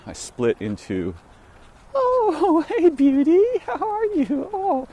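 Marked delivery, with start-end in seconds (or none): 1.9-4.9 put-on very high, cooing baby talk with a laugh; greeting an old dog, giggly very high coo; high-pitched animal voice. Shown as a demonstration. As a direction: gush up high like greeting a beloved pet. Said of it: "this kind of speaking".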